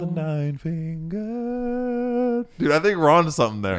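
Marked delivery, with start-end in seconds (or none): 0.0-2.5 slow, high, drawn-out epic title; horror host. Pretend. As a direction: intone it like an ominous legend.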